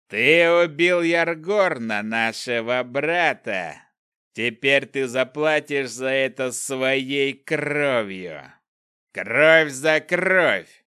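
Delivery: slow, loud, snarling growl. Acted. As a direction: growl it like a cornered beast's hunter. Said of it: "прорычал один из них".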